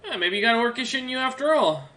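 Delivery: put-on high young man's voice; young soldier. Young soldier teasing Centauri about orcish blood, high-pitched. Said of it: "the young man kinda looks over".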